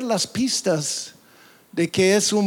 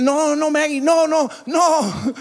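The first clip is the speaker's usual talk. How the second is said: regaño imitado; 8 semitones higher than usual. Retold or imitated. Regañando a la perra Maggie.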